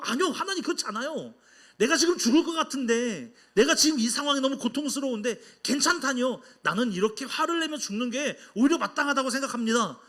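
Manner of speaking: high-pitched, insistent objection; brazen protest. Acted. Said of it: "너무 뻔뻔하게 이렇게 나오는 때". (뻔뻔하게 따지듯 높은 목소리로)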